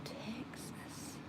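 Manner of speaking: near-whispered, rising echo; soft, wondering whisper; hushed surprise. Real, not acted. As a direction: breathe it out softly, pitch lifting. Repeats the surprising state softly.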